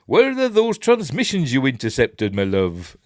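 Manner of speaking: high, loud West Country take on a villain's line; jokey West Country villain; Dave Prowse. Acted. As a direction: interrogate in a mock southwestern English farmer's accent. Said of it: "accent like that".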